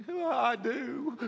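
high, laughing teary "I do"; imitating a crying bride. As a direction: mimic a bride sobbing with joy. Bride crying through her vows.

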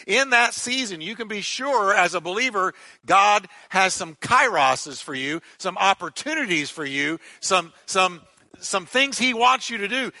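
high, rising fervent preaching; preaching at full force. Acted. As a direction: build the crowd up. Sermon climax on seasons.

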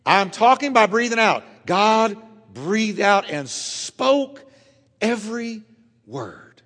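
God breathed out drawn out very slowly, word fading away; preacher savouring God's spoken word; emphatic, slowing. Real, not acted. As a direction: stretch every word out, then let it drop.

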